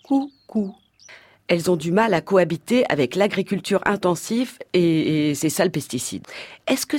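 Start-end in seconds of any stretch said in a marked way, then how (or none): 0.0-0.7 "Coucou" sung as two falling notes, higher, like the bird; cuckoo call imitation. Shown as a demonstration. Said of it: "le coucou n'en possède que deux".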